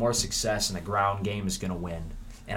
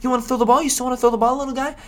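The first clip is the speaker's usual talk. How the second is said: put-on high, condescending baby-talk to a player; mocking coach voice; 8 dB louder, much faster than usual. Coach teasing his quarterback in a high sing-song voice. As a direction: coax him like a little kid, way up high. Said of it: "Josh Gaz is gonna be like".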